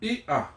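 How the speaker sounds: "Ia" as ee, tiny break, ah; hiccup between vowels. Shown as a demonstration. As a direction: put a little hiccup in the middle of "Ia". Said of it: "make a hiccup or a little separation".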